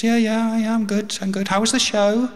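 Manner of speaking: breezy small talk before bad news; wife's voice.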